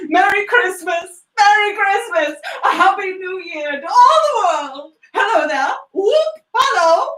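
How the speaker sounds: joyful near-tearful cries; ecstatic shouting. Acted. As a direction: shout it to the whole world. Scrooge shouting Christmas greetings.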